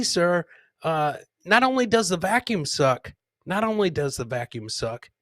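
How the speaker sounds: ordinary talk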